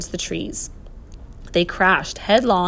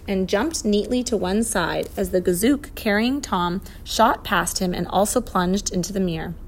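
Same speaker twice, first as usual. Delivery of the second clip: usual speaking voice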